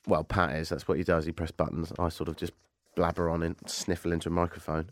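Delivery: a low, croaky voice from a cold; sick voice. Real, not acted. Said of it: "You sound sick".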